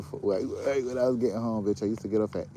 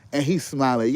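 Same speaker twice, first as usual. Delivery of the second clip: usual speaking voice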